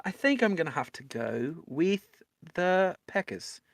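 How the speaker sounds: put-on half-hearted British accent drifting toward Australian. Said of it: "my British accent starts to morph a little bit halfway through".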